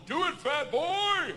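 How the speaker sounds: high, drawn-out mock crowd chant; crowd egging him on. Five hundred women goad him to jump, mock crowd yell. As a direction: call it out like a rowdy crowd.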